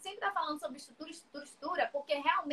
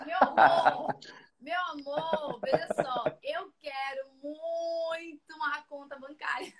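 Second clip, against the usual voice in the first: laughing; 7 dB louder than usual. Real, not acted. Laughing about Juliette comparison.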